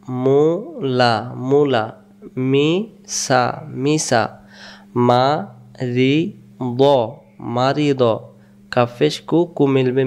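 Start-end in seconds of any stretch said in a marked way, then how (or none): 0.1-1.3 Mula drawn out slowly; teacher slowing Mula down for the class; slow demo. Shown as a demonstration. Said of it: "slow then normal take". 2.4-3.6 Misa drawn out slowly; teacher slowing Misa down for the class; slow demo. Shown as a demonstration. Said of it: "slow then normal take". 4.9-6.8 মারিদ drawn out very slowly; teacher slowing মারিদ right down; very slow demo. Shown as a demonstration. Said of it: "slow then normal take".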